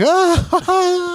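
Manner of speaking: high squealed ah of panic; high mock cry of dismay. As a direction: shriek at the bad news. Hearing grade requirement.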